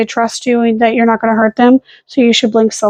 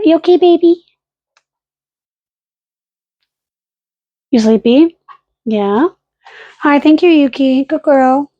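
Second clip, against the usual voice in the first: soothing pet voice; 2 semitones higher than usual. Real, not acted. Comforting dogs.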